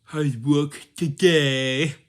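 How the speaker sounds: very loud, high, slow goofy voice; exaggerated silly shouting; silly talk. Shown as a demonstration. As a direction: blare it out, dragging the last word. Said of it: "You don't sound like this".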